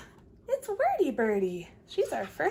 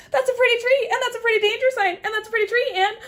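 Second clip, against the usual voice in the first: high, loud, fast gushing; rapid excited chatter; 10 semitones higher, 7 dB louder, much faster than usual. Acted. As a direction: rattle along without a breath. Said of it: "she has plenty to say".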